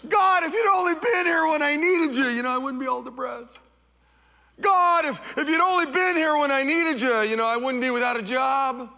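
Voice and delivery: high, loud, anguished cry at God; anguished complaint. Retold or imitated. Believers crying out to God, loud anguished lament. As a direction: wail it out like a hurting believer. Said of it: "haven't we all said that?".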